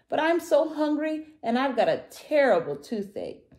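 high, loud character whine; complaining little dinosaur. A narrator's reading. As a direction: plead hunger and pain in a high voice. Tiny complains of toothache.

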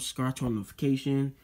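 ordinary talk

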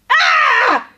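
high, loud enraged bellow, slow on the question; outraged master of the house yelling at the sky; furious shout. Acted. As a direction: roar it from the dark garden. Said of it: "il grido pieno di rabbia di un uomo".

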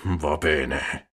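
grudging consent to Don Camillo; through gritted teeth. A narrator's reading. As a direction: grit your teeth on it. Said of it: "a denti stretti".

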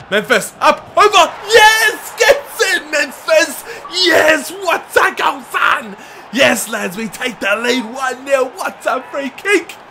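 screaming, high and loud with joy; goal celebration. Real, not acted. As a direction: roar as the ball goes in. Free kick goal celebration.

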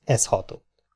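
ordinary delivery

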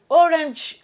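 Orange loud and high, English-style; mock English accent. Shown as a demonstration. Vlogger showing off to viewers, mock English accent. Replays English pronunciation of title.

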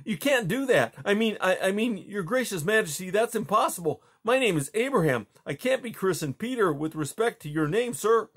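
high, anxious pleading; boy's startled protest. Acted. Boy begging the Tsar not to rename him, anxious. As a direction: plead against it nervously.

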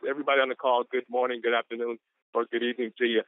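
ordinary talk

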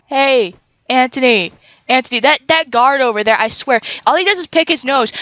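ordinary talk

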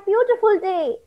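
ordinary delivery, nothing marked

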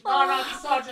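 frantic high, loud demand; real screaming. Real, not acted. Streamer begging partner to dissociate, screaming. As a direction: shout it in a panic. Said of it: "Beh, abbiamo urlato".

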